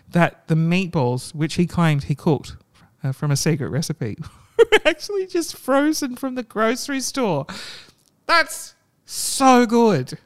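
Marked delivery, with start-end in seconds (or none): 4.4-7.5 laughing. Real, not acted. Laughing at the punchline. 8.3-10.2 laughing delight. Real, not acted. Reacting with delight.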